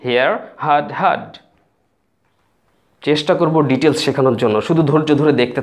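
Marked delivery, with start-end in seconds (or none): 0.0-1.4 slow model pronunciation, হিয়ার হার্ড হার্ড; teacher modelling হিয়ার হার্ড হার্ড slowly; careful demo of here heard. Shown as a demonstration. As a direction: say হিয়ার হার্ড হার্ড slowly, each word drawn out. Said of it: "কি বলবেন?".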